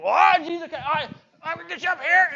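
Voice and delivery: high, mock-panicked cry while being pulled.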